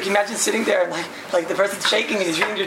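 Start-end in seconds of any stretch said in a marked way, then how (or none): none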